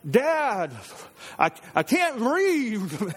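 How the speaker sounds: high, drawn-out mock child's whine; distressed child. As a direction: imitate a panicked kid struggling to speak. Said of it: "her lip, she's like".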